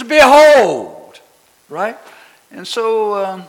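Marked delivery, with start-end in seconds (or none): 0.0-1.2 ringing scripture quote. Acted. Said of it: "you've read it in the scriptures".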